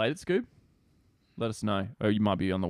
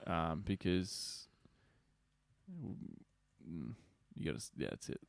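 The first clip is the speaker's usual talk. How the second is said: trailing off; 5 semitones lower, 8 dB quieter than usual. Real, not acted. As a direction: voice fading away, distracted. Speaker fades, distracted reading.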